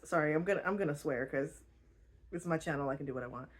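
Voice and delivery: ordinary delivery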